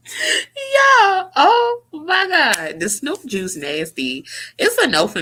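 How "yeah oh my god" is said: high, teary laugh-cry; real laugh-crying, voice breaking high; crying with laughter. Real, not acted. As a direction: laugh till the tears come. Laughing again at the juice.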